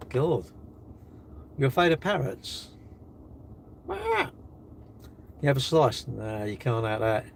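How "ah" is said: short, high-pitched parrot squawk. Acted. Imitating a parrot after question.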